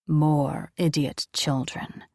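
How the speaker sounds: low, slow angel muttering; low slow mutter of scorn. Acted. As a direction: grumble it under the breath, contemptuous. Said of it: "he muttered".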